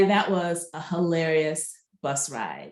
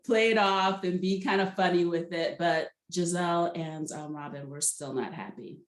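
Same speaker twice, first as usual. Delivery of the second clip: normal talking voice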